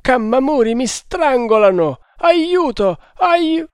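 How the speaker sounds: high, loud panicked shrieks, cut off at the end; screaming for help. Acted. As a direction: scream as if being strangled. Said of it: "gli soffocò le grida".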